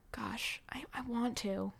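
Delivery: slow breathy whisper. Real, not acted. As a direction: admit it softly to yourself, slowly. Quiet confession about resolutions.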